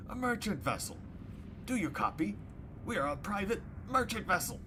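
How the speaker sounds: another crew voice. Acted. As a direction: pitch it high and loud, insisting over the radio. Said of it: "by another".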